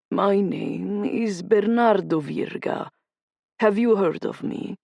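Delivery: put-on slow, deliberate Italian-accented introduction. A narrator's reading. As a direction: introduce yourself like a Sicilian boss. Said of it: "his Italian accent thick".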